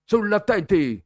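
high, loud yelled order; shouted command. A narrator's reading. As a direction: shout it at one boy. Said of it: "gridò Bertoli". (Bertoli grida l'ordine fissando il ragazzo, forte)

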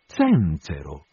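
slow, drawn-out repeated question. A narrator's reading. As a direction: stretch the word slowly, suspicious. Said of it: "gli dice pian piano".

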